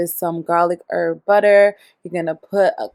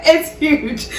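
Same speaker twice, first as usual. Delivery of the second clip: laughing; 9 semitones higher than usual. Real, not acted. Big lobster amuses her.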